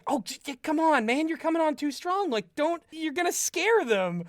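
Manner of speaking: lightly voiced friends telling a hothead to ease off; calming him down. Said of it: "kinda the aggressive one".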